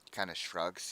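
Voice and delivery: ordinary talk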